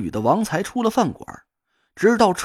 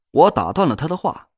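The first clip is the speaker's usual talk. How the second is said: ordinary delivery, nothing marked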